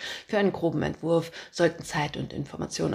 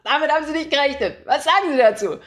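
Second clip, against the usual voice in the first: much higher and louder, triumphant roar; bellowing boss; 8 semitones higher, 6 dB louder than usual. Acted. Chief editor bellowing his scoop at the reporter. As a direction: shout the big news down the line. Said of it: "da brüllt er schon los".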